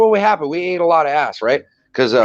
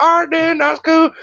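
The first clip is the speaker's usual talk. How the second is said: breezy brush-off; 12 semitones higher than usual. Retold or imitated. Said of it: "I was like".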